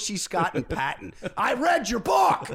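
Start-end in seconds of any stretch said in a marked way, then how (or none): 1.4-2.5 slow, high, loud fan shout; excited shout. Retold or imitated. Yell it out slowly, much higher and louder. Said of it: "I'm screaming".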